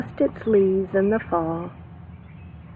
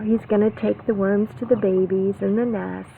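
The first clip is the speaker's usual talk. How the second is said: ordinary talk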